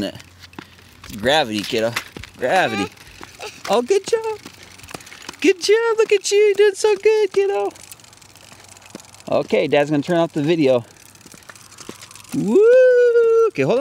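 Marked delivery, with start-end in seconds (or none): 3.7-4.4 very high, delighted good job. Real, not acted. Dad cheers toddler. 5.4-6.0 very high and louder good job; high excited praise. Real, not acted. Dad cheers toddler, high. 6.0-7.7 very high, louder praise. Real, not acted. Dad cheers toddler. 12.3-13.5 very high, loud whoop; excited woo. Real, not acted. Dad cheers at the end.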